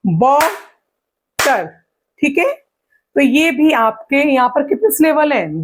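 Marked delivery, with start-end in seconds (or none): 0.1-1.7 "बॉटर" as two beats with a long gap, बॉ, टर; split into syllables. Shown as a demonstration. Teacher counting syllables by clapping, बॉटर in two separate beats. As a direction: hold बॉ, pause, then drop टर. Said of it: "कि ताली बजा के".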